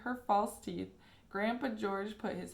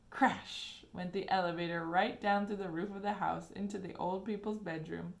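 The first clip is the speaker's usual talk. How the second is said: ordinary delivery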